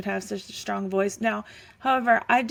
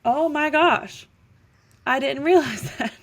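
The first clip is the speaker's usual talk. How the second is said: retold astonishment; 4 semitones higher, 4 dB louder than usual. Retelling spotting the same actor in Star Wars, astonished. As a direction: replay a stunned realization, pitched up. Acts her own astonished realization.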